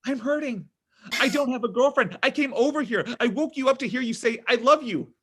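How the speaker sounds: needy, rising begging; whiny plea. Acted. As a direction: beg like a hurt child. Son begging parents for love.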